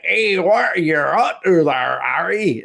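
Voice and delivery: slow, lightly voiced Cockney giant; a hint of Hagrid's Cockney; Cockney accent. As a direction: drawl it like a rough Cockney groundskeeper. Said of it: "in very Cockney kinda, like, accent".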